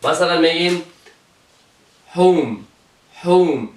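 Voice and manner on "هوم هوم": "هوم" begun with an H pushed from the belly; breath H from navel. Shown as a demonstration.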